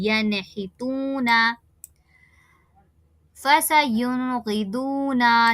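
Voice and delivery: यनहितूना with नून distinct, slow; clear noon. Shown as a demonstration. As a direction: say फसयुनगिदूना slowly, नून clearly heard. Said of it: "नून को वाज़ेह करना है". (नून वाज़ेह करके पढ़ना)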